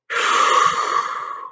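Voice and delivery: long breathy blow of air; wolf blowing at the house; blowing puff. A narrator's reading. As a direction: blow hard like huffing wolf. Wolf blows.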